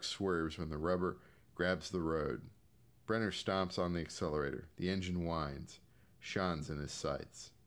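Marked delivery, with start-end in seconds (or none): none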